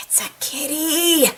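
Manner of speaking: high, stretched-out cooing; gushing over a cat. Pretend. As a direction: coo it with a long drawn final word. Cat lover spots a kitty.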